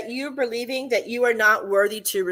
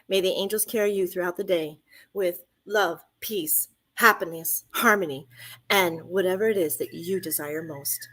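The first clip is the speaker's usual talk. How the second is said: lower, gentle benediction to listeners; soft blessing; 4 semitones lower, 3 dB quieter than usual. Acted. Host blesses listeners at the end of the show, softly. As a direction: send them off with a blessing.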